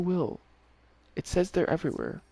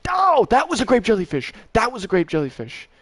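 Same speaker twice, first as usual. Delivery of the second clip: much higher, loud excited yell; excited shout; 8 semitones higher, 9 dB louder than usual. Real, not acted. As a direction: burst out with joy at the catch. Excited when the fish appears.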